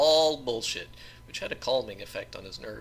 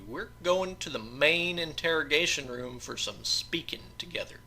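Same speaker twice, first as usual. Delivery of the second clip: stern sheriff; 4 semitones higher, 6 dB louder than usual. Acted. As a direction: preach it like a stern lawman. Sheriff lectures George.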